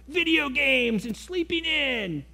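put-on high, drawn-out kid voice; kid piping up. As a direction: pipe up like a kid, stretching the words out. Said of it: "your kid pipes up and says".

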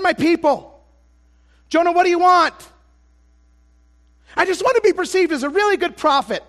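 loud, high preaching at full force. Acted. Preacher confessing his selfish wants to the congregation at full force. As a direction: drive the confession home to the congregation.

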